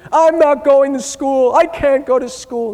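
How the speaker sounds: high, loud mock-sobbing teen; crying teen. Dad imitates sobbing daughter refusing school, high wail. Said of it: "And she starts crying".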